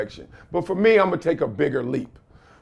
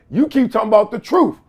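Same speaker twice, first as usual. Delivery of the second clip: ordinary talk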